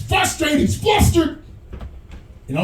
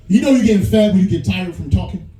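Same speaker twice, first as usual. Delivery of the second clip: usual speaking voice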